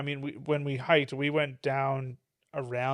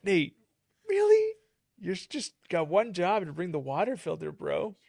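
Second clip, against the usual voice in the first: exasperated; 4 semitones higher than usual. Retold or imitated. Retells scolding friend over water filter.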